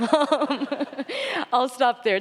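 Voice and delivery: high laugh into an um. Real, not acted. Reader wrapping up the reading, laughing into an um. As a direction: still giggling as you try to go on.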